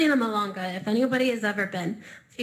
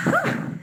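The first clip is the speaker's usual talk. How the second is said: 1 breathless whew. Real, not acted. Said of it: "I'm a little out of breath now".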